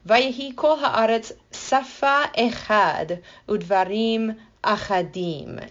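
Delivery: slow Hebrew recitation, carefully sounded; Hebrew reading. Shown as a demonstration. Teacher reading Hebrew verse to the listener, slow and careful. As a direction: read the Hebrew verse slowly and deliberately. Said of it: "this says".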